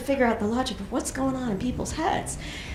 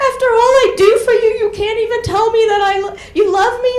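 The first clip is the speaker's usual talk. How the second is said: much higher, loud crying wail; sobbing mother; 11 semitones higher, 9 dB louder than usual. Retold or imitated. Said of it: "She's like".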